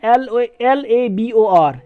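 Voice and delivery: "एल ए बी ओ आर" spelled slowly letter by letter, falling on "ओ"; American spelling "एल ए बी ओ आर" dictated slowly. Shown as a demonstration. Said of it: "लेकिन American जो है इसमें".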